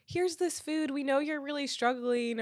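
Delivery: lightly mock a pitying charity giver; imitated condescending helper. Voicing a condescending would-be helper.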